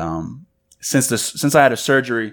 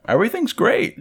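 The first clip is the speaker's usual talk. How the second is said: high-pitched reassurance; 12 semitones higher than usual. Retold or imitated. Reassures fiancée's worried mother, retold.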